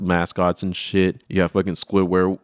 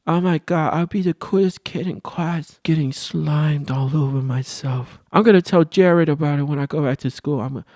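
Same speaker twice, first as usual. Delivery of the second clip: younger self; 7 semitones higher than usual. Retold or imitated. Boy bragging about getting slimed, high kid voice. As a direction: gush like an excited kid. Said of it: "this is probably how I sounded back then".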